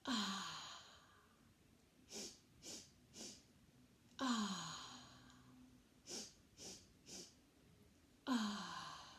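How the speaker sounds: soft contented ah on the exhale; bunny breath sigh. Shown as a demonstration. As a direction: sigh out like sniffing a flower. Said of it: "let's try that three times".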